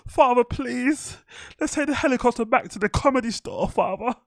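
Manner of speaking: put-on high, whiny rich-kid voice begging his father; big boy voice. Mocking a comedian begging his rich dad in a high whine. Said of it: "Elizabeth Holmes voice on".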